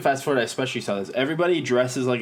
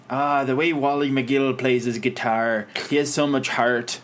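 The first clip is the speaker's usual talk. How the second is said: put-on pretentious Newfoundland accent. Calgary folk-fest fan praising a guitarist, in pretentious fake Newfoundland accent. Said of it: "like, Newfoundland-sounding accent".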